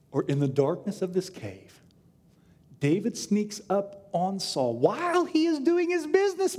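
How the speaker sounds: ordinary delivery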